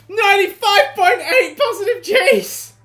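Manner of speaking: loud, high disbelieving read-out; astonished. Real, not acted. Player reading the g-force figure, amazed. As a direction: shout the number, amazed.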